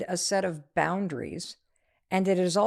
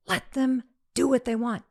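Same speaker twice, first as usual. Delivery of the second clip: higher, firm and final; firm daughter; 5 semitones higher than usual. Retold or imitated. Daughter telling mom it's their prom, firm. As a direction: lay it down firmly. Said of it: "Mom, it's their prom, not yours".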